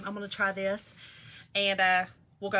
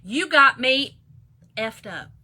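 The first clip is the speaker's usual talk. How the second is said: loud first words, then dropping to normal; half-hearted mock yell; retold screaming; 9 dB louder, slower than usual. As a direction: imitate a scream, letting it fall off. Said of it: "She screams to the top of her lungs".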